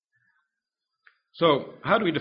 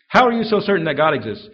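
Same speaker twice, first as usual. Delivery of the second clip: louder, pressing the question hard; loud challenging question; 5 semitones higher, 9 dB louder than usual. Retold or imitated. Preacher retelling the skeptic's dinner challenge, loud and pressing. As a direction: throw the skeptic's challenge back loudly.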